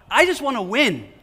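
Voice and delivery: preacher playfully shouting a greedy wish; mock greedy outburst. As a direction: blurt out the greed like a spoiled kid. Mocking greedy inner voice.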